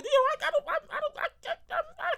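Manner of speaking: breaking into high laughter; laughing through the words. Real, not acted. Stammering into laughter.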